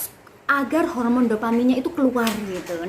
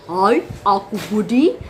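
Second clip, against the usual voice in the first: slow, big dad voice; deep father voice; 4 semitones lower, 3 dB louder, slower than usual. Shown as a demonstration. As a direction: boom it like a father. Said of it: "Jadi ada lima ya".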